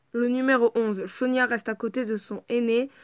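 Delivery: ordinary delivery, nothing marked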